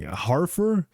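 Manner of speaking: "Harfer" said HAR-fer with an H sound, high and questioning; alternative pronunciation. Shown as a demonstration. Host trying another reading of the title, alternative pronunciation. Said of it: "it's pronounced Jarfer or something".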